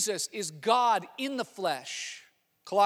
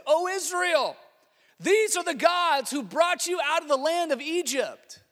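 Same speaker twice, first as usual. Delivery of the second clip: exclaiming; 9 semitones higher, 6 dB louder than usual. Acted. People exclaim over golden calf.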